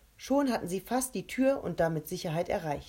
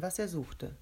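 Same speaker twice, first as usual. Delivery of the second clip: ordinary delivery, nothing marked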